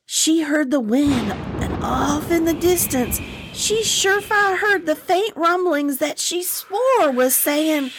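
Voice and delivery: lilting folktale narration; Southern story narration. Acted. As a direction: spin the tale for the listeners. Reads the story aloud.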